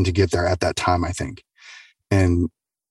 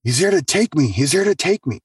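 higher, urgent, repeating it; scared insistence; 7 semitones higher than usual. Retold or imitated. Patient to her family about the unseen figure, higher and scared. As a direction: insist in fear that he's come for her. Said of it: "She's like".

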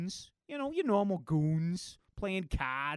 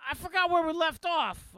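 character shout; 11 semitones higher, 6 dB louder than usual. Acted.

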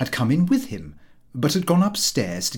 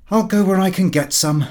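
raised, higher defiant outburst; defiant retort; 6 semitones higher, 5 dB louder than usual. Acted. Son defying his father, a louder defiant outburst. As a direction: snap back at father, louder. Lupin storms off after refusal.

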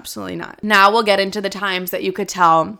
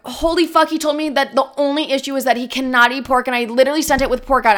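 high, urgent mock panic; retold freak-out; 5 semitones higher, faster than usual. Server sent pork by mistake, retold freak-out. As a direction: spill it out in acted alarm. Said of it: "I literally freak out".

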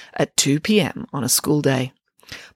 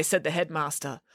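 ordinary delivery, nothing marked

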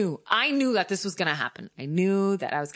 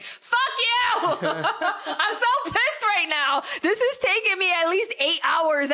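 much higher, laughing, slowed exasperation; laughing outburst; 11 semitones higher, slower than usual. Retold or imitated. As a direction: laugh it out, fed up. Exasperated at tech support.

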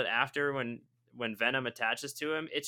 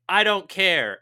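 a blunt, louder brush-off, pitched higher; dismissive; 6 semitones higher, 10 dB louder than usual. Retold or imitated. Eddie brushing off the reporter, mock dismissive and louder. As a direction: toss it off like you couldn't care less.

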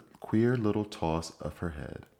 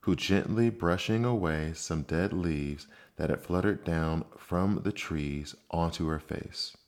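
usual speaking voice

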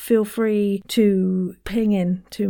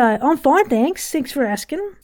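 higher Antipodean accent, rising at each end; Antipodean upspeak; 5 semitones higher than usual. Shown as a demonstration. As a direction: lift every phrase end in an Antipodean accent. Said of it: "the sentences all seemed to go up".

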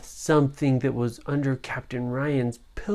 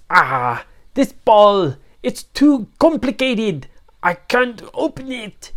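frustrated pirate captain; 8 semitones higher, 8 dB louder than usual. Acted. Pirate captain struggles with ball.

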